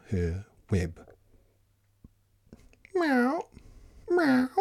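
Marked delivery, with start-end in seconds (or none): 2.9-4.5 high little meow; cat's meow. Acted. Cat calling to the spider, high meow. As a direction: mew it high like a kitten. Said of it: "cried the cat".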